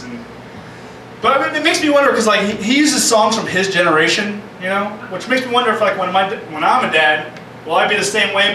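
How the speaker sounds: ordinary talk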